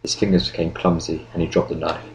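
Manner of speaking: ordinary delivery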